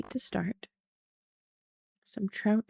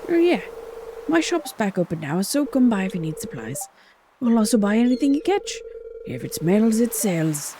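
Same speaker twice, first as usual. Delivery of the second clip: put-on old fisherman chatting up the newcomer, hearty; 4 dB louder than usual. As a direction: play a jolly weathered fisherman. Voicing Willy the fisherman.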